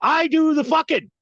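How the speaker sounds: shouted high, defiant. Real, not acted. Host yelling at the rude show hosts, defiant shout. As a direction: fling it at them. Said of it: "I fuck with you harder".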